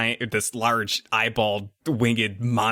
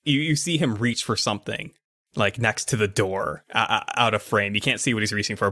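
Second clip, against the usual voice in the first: usual speaking voice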